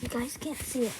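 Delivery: ordinary delivery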